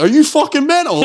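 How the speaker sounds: high-pitched outraged disbelief; mock incredulity. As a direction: squeal it in hammed-up horror. Gangster horrified on hearing brothers' name.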